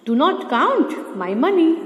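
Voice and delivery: very slow, every word spaced out; slow, deliberate dictation of an English sentence. Shown as a demonstration. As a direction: dictate it word by word so students can write. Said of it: "teacher reads finished sentence slowly".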